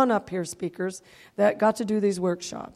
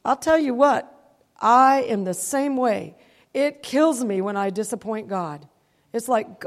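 ordinary talk, nothing marked

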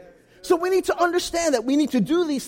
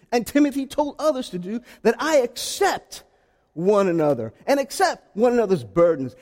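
normal talking voice

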